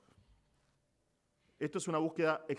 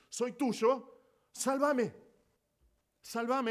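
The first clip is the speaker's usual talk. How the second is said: high, pressing plea, a little louder; cry for help; 8 semitones higher, 3 dB louder, much slower than usual. Retold or imitated. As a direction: cry out to God for rescue. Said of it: "vemos un grito acá".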